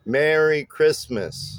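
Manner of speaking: "Merry Christmas" slowly, MER and CHRIST stressed; measured strong-weak beats on "Merry Christmas"; trochaic rhythm. Shown as a demonstration. Bass teacher showing trochees, stressed-unstressed rhythm on "Merry Christmas". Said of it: "stressed syllable followed by an unstressed syllable".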